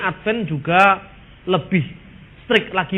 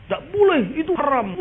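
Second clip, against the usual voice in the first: a hint of a stern preacher, pitched higher; preacher's stern ruling; 8 semitones higher than usual. Imitating Zainuddin MZ's answer to the question, a hint of high stern preacher. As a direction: lightly impersonate a strict preacher forbidding it. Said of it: "Tapi kalau Jainuddin M Z".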